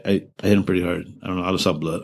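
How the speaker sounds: ordinary talk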